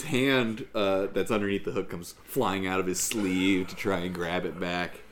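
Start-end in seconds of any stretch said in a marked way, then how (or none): none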